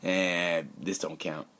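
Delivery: softly tossed-off brush-off; dismissive imitation. Rider waves away the early races, mock dismissive. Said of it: "He said it kinda like".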